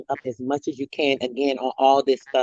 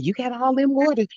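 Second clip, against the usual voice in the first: lightly voiced old lady, pitched higher; sweet old lady; 7 semitones higher than usual. Old lady remarking on the shopper's cart, lightly imitated. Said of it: "She was a sweet little old lady too".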